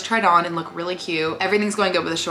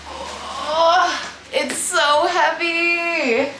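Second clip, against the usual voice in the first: straining; 8 semitones higher, much slower than usual. Real, not acted. Lifting the heavy machine.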